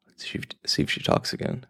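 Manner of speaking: hushed, quick near-whisper; soft, hurried, barely voiced aside; speaking quietly. Real, not acted. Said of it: "speak it, like, slowly or quietly".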